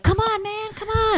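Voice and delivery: high, whiny mock plea; pleading Chance. Friend retelling Chance's plea, high whiny mock begging. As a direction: beg him in a squeaky put-on voice. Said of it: "And Chance is like".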